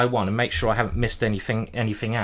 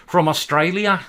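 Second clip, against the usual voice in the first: put-on a jokey Australian accent, raised and louder; mock Australian accent; 5 semitones higher, 5 dB louder than usual. Streamer naming his Australian opponent in a mock Australian accent. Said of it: "my, my great accent there".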